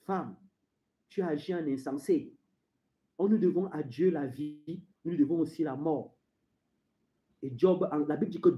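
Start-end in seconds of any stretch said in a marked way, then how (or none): 0.1-6.1 low, soft, composed rebuke; Job. Acted. Job answering his wife's challenge, composed. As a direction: answer quietly and deep, unmoved. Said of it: "Job a dit".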